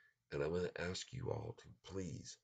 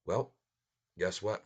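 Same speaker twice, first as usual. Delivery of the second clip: ordinary talk, nothing marked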